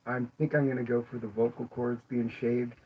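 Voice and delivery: ordinary delivery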